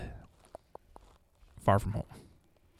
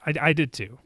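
usual speaking voice

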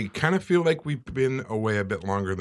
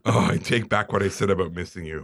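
usual speaking voice

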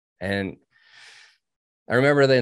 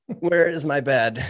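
higher, drawn-out line through a laugh; laughing mock plea; 4 semitones higher, slower than usual. Exhausted trainee after Hell Week, laughing slow plea. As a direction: laugh while playing the wiped-out trainee.

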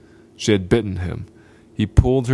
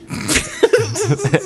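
a high, mocking laugh; a hammed-up high laugh. Laugh after boast.

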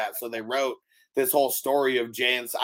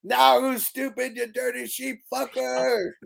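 mock taunt; 10 semitones higher than usual. Character taunting in retold scene.

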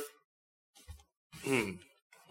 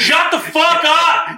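shouting; 13 semitones higher, 3 dB louder, slower than usual. Real, not acted. Mock outrage at friend's joke.

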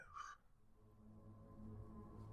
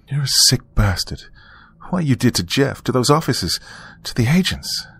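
higher-pitched indignant list; accusing; 7 semitones higher than usual. A narrator's reading. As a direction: confront him, voice pitched up on each charge. Accusing the killer of his crimes.

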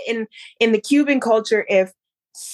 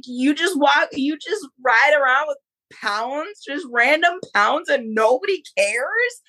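higher pitch, rising disbelief; retold bafflement with upward lilts; incredulous questioning; 6 semitones higher than usual. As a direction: question it phrase by phrase, amazed. Disbelief at carrying pounds around.

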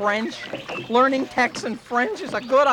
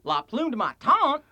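Texan French. Acted. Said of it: "speak French like a Texan".

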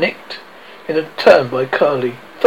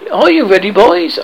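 high, loud, merry call; 5 semitones higher, 6 dB louder than usual. A narrator's reading. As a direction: call out gaily to the boys.

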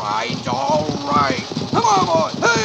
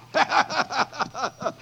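quiet amused chuckle; soft chuckle; 5 dB quieter than usual. Acted. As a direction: chuckle at your own joke. Laugh after joke.

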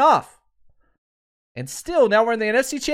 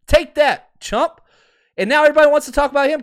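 loud, higher taunting yell that rushes at the end; gloating shout; 8 semitones higher, 6 dB louder, faster than usual. Real, not acted. Fan gloating at Rodgers' playoff losses, loud taunting yell. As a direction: rub it in the rival's face at full volume.

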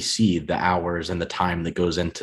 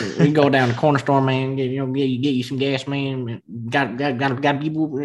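higher, fast Midwestern slur with words run together; Midwest mumble; 6 semitones higher than usual. Retold or imitated. Telling the Swede how Midwesterners talk, mumbled Midwest accent. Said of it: "It just mumble the words together".

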